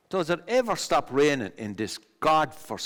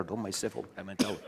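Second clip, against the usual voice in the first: normal talking voice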